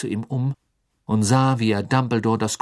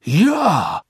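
laut herausgepresst; hervorgestoßen; 6 semitones higher, 8 dB louder than usual. Acted. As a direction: hastig ausstoßen. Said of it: "stieß Karkaroff hervor". (Karkaroff stößt die Antwort hervor)

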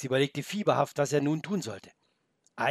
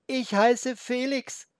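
put-on high, slow young boy; boy's voice; 11 semitones higher, 4 dB louder, much slower than usual. The boy Felix introduces himself to the wolf, high and slow.

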